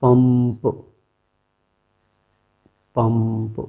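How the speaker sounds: पंप with the nasal closing to m before p; पंप said slowly with lips closing on the nasal; पंप, अनुस्वार को म की तरह; anusvara as m demo. Shown as a demonstration. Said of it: "जैसे".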